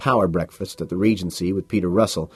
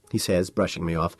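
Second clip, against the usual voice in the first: ordinary delivery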